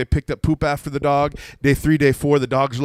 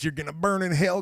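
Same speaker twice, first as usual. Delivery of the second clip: higher, mock Southern hellfire preacher; Southern accent; 5 semitones higher than usual. Judgmental preacher to sinners, Southern accent. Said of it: "Another Southern".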